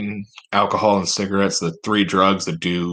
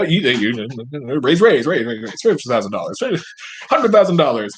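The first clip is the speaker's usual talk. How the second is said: cattle auctioneer; 7 semitones higher than usual. Pretend. Auctioneer selling senators to a buyer, high rattling chant. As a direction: chant the bids like a livestock auctioneer. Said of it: "It really is like a fucking cattle auction".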